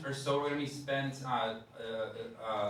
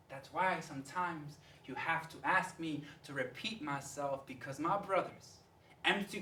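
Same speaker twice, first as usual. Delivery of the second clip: hushed slam poetry; 5 semitones higher than usual. Acted. Poet explaining himself to the teacher, hushed slam delivery. Poem drops to a hush.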